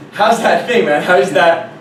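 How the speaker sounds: incredulous. Retold or imitated. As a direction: challenge them, baffled and loud. Retold incredulous reply to instructors.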